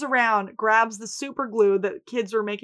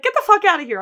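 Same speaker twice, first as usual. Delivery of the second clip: mock outraged refusal; 10 semitones higher, 7 dB louder, much faster than usual. V refusing, retold.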